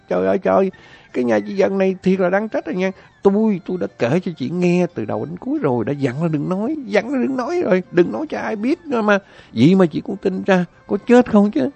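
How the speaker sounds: high shrill-free loud mock rebuke rising; angry rebuke. Wife reproaches neighbour Dần, high loud mock rebuke. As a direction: blame her friend hotly. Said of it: "đùng đùng trách cái Dần".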